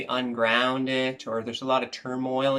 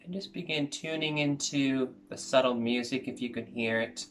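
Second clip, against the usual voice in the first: soft, calm meditation guide voice; guided meditation; 5 dB quieter than usual. Acted. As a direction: ease them gently into stillness, softer as you go.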